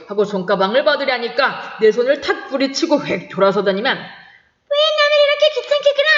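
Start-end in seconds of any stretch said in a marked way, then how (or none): 4.0-6.1 very high, fast mincing woman's voice; mimicking a girl. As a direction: squeak like a prim schoolgirl. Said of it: "정말 꾀꼬리 같은 소리를 내었다".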